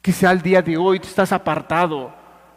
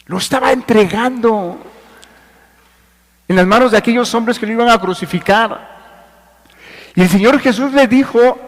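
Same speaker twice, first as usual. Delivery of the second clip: raised, emphatic sermon voice; forceful preaching; 6 semitones higher, 5 dB louder than usual. Real, not acted.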